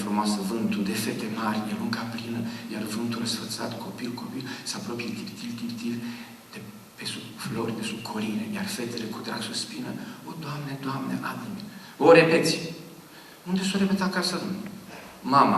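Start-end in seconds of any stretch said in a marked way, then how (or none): 0.0-11.6 soft, hushed reciting of Coșbuc; reading the poem quietly, dreamy; quiet poem recital. Retold or imitated. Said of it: "tu ai față ingenuă".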